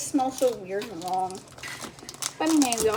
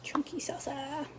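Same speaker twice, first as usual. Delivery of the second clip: soft unvoiced whisper; 4 semitones higher, 8 dB quieter than usual. Real, not acted. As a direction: breathe it out in a whisper. Whispering about the salsa.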